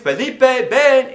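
loud, high call across the yard. Retold or imitated. Mother calling her son home to eat, loud and high. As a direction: call your boy in from far off.

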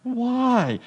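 high, drawn-out kid imitation; questioning kid. Answering what kids always ask, childlike why. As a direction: pester like a small child. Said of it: "what's the question kids always ask".

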